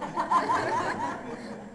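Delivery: high breathy cackle of amusement; high mock laugh. God laughing at the pastor, high teasing cackle. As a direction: laugh like you know the secret. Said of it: "God's up there laughing".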